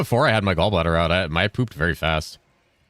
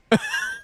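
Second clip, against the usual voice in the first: high laugh. Real, not acted. Bursts out laughing.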